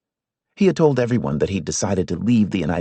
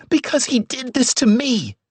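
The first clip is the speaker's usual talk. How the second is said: near scream. A narrator's reading. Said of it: "she nearly screamed".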